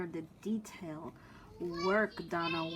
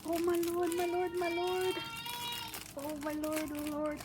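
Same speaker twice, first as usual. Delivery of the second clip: overwhelmed delight; 10 semitones higher than usual. Real, not acted. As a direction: marvel like it is too much to bear. Overwhelmed by the gift.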